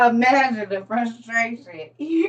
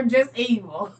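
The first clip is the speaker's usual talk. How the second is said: normal talking voice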